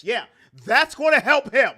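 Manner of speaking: loud mocking sarcasm; loud sarcastic jab. Real, not acted. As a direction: jeer it like the idea is absurd. Sarcastic mock agreement.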